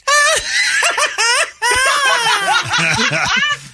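high, hammed-up staccato cackle; laugh in Spanish. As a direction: put on a long high mock laugh. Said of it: "laugh in Spanish".

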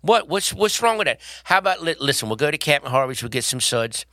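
chatty put-on Baltimore accent. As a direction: play a local Baltimorean, vowels and all. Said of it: "honey, you do Baltimore".

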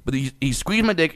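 ordinary talk, nothing marked

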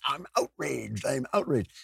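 strained, put-on indignant voice; hammed-up outrage in a squeezed voice; mock outrage. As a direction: imitate a perpetually offended person. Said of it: "like, angry people".

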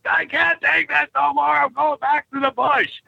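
high-pitched, fed-up outburst, acted out; mock exasperated cry; snapped. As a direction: act out reaching breaking point, pitch way up. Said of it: "I just about snapped then and went".

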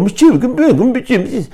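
high, quick Maghrebi-sounding imitation; mock Moroccan reply. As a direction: fire it back like a Moroccan friend. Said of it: "وهذاك يقول".

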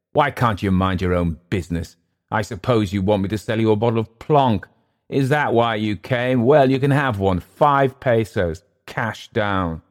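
rebuff the visitor, then spell out terms; testy recluse. Acted. Clift offering the captain a bottle, testy.

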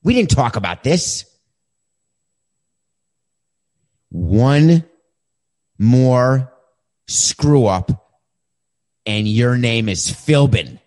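mock calm dressing-down. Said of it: "I'm very quietly and calmly saying".

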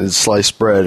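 normal talking voice

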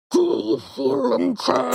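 low, muffled masked-villain voice; badly faked villain through covered mouth; Bane. Attempting the line with hands over mouth, muffled villain. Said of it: "you have to put your hands over your mouth".

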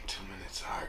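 whispering. Real, not acted. Off-mic aside to crew.

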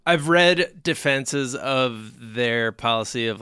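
ordinary talk, nothing marked

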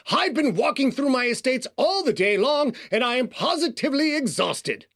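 put-on over-the-top old-money East Coaster, high-pitched; hammed-up East Coast aristocrat accent. A narrator's reading. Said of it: "over-the-top accent of an old money East Coaster".

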